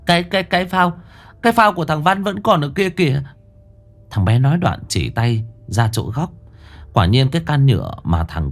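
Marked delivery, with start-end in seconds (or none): 0.0-3.2 put-on high, shaken child voice, tripping over words; a scared boy explaining to the elders what happened; stammering child. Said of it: "Một đứa lắp bắp". (giọng trẻ con lắp bắp, run sợ)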